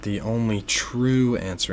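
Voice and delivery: "true" drawn out with emphasis; stress on TRUE. Real, not acted. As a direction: lean firmly on the word "true". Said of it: "emphasis on the word true here".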